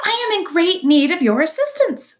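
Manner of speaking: high, loud, cheery announcement; friendly booming. A narrator's reading. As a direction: call brightly across a pond. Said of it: "friendly voice boomed over the water".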